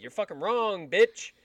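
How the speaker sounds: half-shouted insult, rising; yelling. Retold or imitated. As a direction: snap it out loud. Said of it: "and I was yelling".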